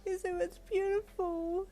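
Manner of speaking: a teary, awed compliment; sobbing. Acted. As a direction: choke it out through happy tears. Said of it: "you sobbed".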